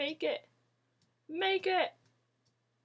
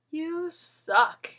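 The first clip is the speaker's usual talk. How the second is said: usual speaking voice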